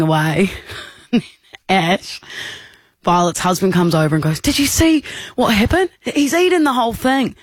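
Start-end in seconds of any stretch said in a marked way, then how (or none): 4.4-7.3 higher, incredulous report; retold astonishment. Husband telling friends about the cake, retold astonishment. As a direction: imitate a scandalised husband, pitched up.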